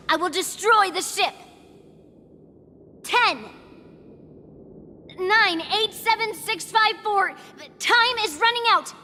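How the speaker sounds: threatening countdown. Acted. Threatening hidden passengers with countdown.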